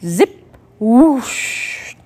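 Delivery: punchy zip then whoosh. A narrator's reading. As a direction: make the coat's sounds. Sound effects for coat coming off.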